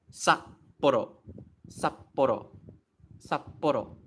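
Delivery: "Sapporo" with "Sap" cut short, then a gap before "poro"; "Sapporo" as Sap, silent beat, poro; sound cut off. Shown as a demonstration. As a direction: stop the sound dead after "Sap" in "Sapporo". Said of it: "you have to cut the sound off".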